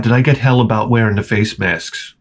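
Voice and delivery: ordinary talk, nothing marked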